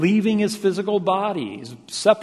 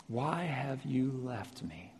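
hushed, slow question; quiet, drawn-out lament; cry of separation; 11 dB quieter, slower than usual. Retold or imitated. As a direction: softly voice the pain of being left. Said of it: "It's a cry of separation".

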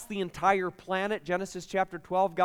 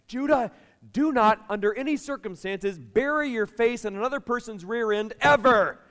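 put-on loud, high stern warning to a four-year-old; stern parent warning; 6 semitones higher, 6 dB louder than usual. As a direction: raise the voice like a dad laying down the law. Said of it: "I'm like".

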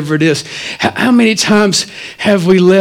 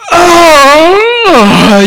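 mock surprise; 8 semitones higher, 4 dB louder than usual. Left hanging, covering the moment.

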